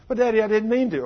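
put-on high, loud whiny child denial; pleading little boy. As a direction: cry out your innocence like a scared kid. Son pleading with father.

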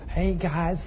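tiny, timid-sounding mock call; too soft. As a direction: call the crew far too softly to be heard. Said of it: "You don't talk to 'em like that".

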